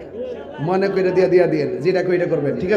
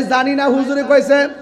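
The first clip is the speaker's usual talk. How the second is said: higher, louder mock retort; shouted excuse; 8 semitones higher, 5 dB louder than usual. Protester answering the police, higher and louder. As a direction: fling the answer back defiantly. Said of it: "কয়".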